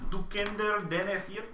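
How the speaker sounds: a lightly voiced stranger speaking mock Danish. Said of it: "says a thing in Denmark".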